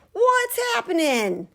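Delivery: loud cheerful What's happening; loud hearty greeting. Acted. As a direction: boom it out like a slap on the back. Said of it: "a loud".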